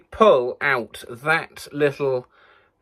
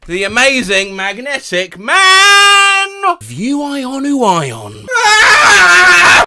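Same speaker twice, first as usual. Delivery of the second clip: announcer shout; 9 semitones higher, 7 dB louder, much slower than usual. Acted. Stunt with magnets in mouth.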